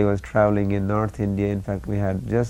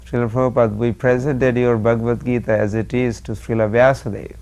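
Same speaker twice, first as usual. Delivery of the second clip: loud, lightly humorous report; humorous announcement; 2 semitones higher, 7 dB louder than usual. Retold or imitated. Disciple reporting to Prabhupada from Badrikashram, humorous announcement. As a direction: proudly announce it, half in jest. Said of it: "in a humorous way, I mentioned to Prabhupada".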